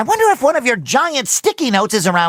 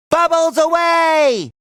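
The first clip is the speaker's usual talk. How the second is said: high, drawn-out battle cry; sustained, high send-off shout; launch call; 5 semitones higher, slower than usual. Acted. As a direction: call it out, stretching the end.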